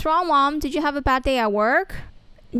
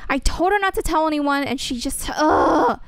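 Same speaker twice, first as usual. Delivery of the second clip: ordinary talk, nothing marked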